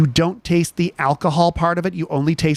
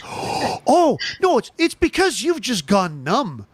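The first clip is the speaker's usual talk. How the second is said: higher, emphatic mock correction; incredulous, pitched-up telling-off; exasperated retort; 6 semitones higher, slower than usual. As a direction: set her straight with comic exasperation. Retold exasperated reply to woman.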